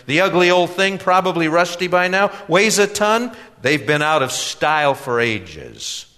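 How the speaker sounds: high, loud salesman running it down; disparaging salesman. A narrator's reading. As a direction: knock the goods, emphatic, dropping at the end.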